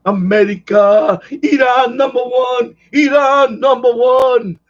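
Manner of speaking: slow, loud wrestling-villain chant, half laughing; Iron Sheik impression. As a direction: bellow it like a heel wrestler taunting the crowd. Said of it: "Like the Iron Sheik".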